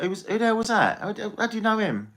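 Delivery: high, startled mock American; high mock bewildered yokel; baffled local. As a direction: squeak out the locals' confusion at the stranger. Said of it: "Be like".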